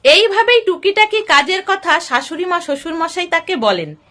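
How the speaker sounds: usual speaking voice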